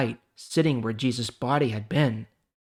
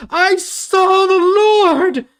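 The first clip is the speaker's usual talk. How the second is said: far higher, louder and slow, ecstatic announcement; joyful cry; 11 dB louder, much slower than usual. Acted. Mary telling the followers, slow ecstatic shout. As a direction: shout it with overflowing joy.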